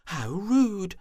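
high, loud indignant cry; indignant squeal. Acted. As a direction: squeal it out, offended. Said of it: "she declared".

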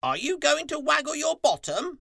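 very high, loud crow voice; high, piercing shouted question; crow calling down. A narrator's reading. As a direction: call it down from a rooftop. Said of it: "Crow looked down from the roof and called".

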